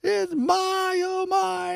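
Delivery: high, drawn-out announcer shout of a home run; Dave Niehaus home run call. Retold or imitated. Boyhood fan mimicking the Mariners announcer's famous call, high excited shout. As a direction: belt it out like a radio baseball announcer calling a homer. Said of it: "He had this call".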